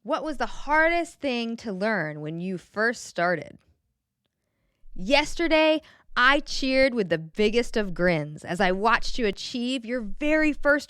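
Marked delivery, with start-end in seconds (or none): none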